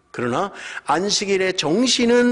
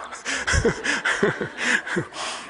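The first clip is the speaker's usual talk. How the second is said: unvoiced laugh; 9 semitones higher, 4 dB quieter than usual. Real, not acted. Laugh after punchline.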